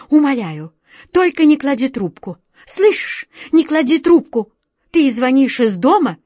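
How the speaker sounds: high, loud, frantic begging; cling to the phone in panic; panicked pleading. A narrator's reading. A frightened director begging his friend not to hang up.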